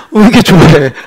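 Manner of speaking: real laughter in the voice; laughing. Real, not acted. Pastor teasing a beaming listener, laughing. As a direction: tease them through a laugh. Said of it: "굴러가는 낙엽에도 이렇게 행복한".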